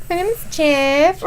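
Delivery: awkward fake Spanish accent, rising at the end; mock Spanish accent. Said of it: "trying to speak with his Spanish accent".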